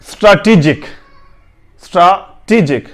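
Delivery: स्ट्रैटेजिक said "straa-tee-jik"; long open aa in the first syllable of स्ट्रैटेजिक; correct pronunciation. Shown as a demonstration. Teacher tells learners to read it this way, correct pronunciation. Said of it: "correct pronunciation".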